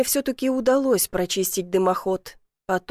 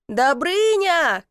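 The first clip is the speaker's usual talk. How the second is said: drawn-out, higher call of a name; 6 semitones higher, 3 dB louder, much slower than usual. A narrator's reading. Woman calling to man at the chimney, calling out. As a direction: call out sharply to stop him. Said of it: "крикнула Василиса".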